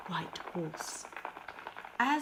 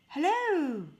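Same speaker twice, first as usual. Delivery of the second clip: higher, louder call into the castle; 9 semitones higher, 9 dB louder than usual. Acted. Pierre calls into the silent castle, loud and high. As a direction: call into an empty hall. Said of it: "he called".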